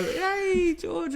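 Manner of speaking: lightly voiced high hail; high-pitched mock greeting. Boxer on horseback hailing him, high mock greeting. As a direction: call it out high, playfully imitating.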